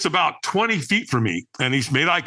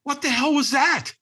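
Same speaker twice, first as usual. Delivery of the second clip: high, emphatic disbelief; baffled; 9 semitones higher than usual. Retold or imitated. Man reacting to a stranger's vanishing, baffled. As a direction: react in baffled astonishment, stretching the last question.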